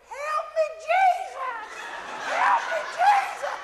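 very high, loud hammed-up wail for help; little southern voice. Preacher imitating his wife at the back of the raft, high Southern squeal. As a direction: squeal it in a mock-terrified Southern woman's voice. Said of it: "this little southern voice at the back".

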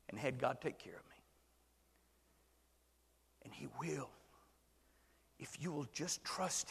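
hushed testimony; whisper. Real, not acted. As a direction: lean in and whisper it. Soft personal testimony.

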